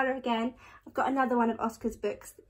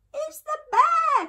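put-on very high, loud child's cry; frightened cry; 6 dB louder than usual. A narrator's reading. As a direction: cry out in high-pitched alarm. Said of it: "cried Eddie".

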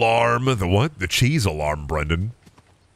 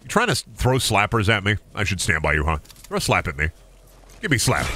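normal talking voice

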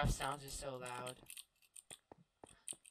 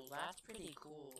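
softer echo, falling; quiet; 2 semitones lower, 10 dB quieter than usual. Acted. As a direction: mutter it back quietly. Repeats own remark more softly.

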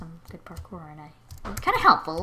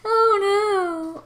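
dismayed surprise; 9 dB louder than usual. Real, not acted. Realising it's the same map.

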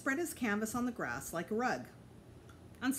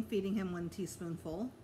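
usual speaking voice

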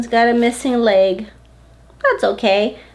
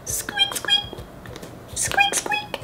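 squeaked very high and thin like a mouse; tiny mouse squeak; 8 dB quieter, slower than usual. Pretend.

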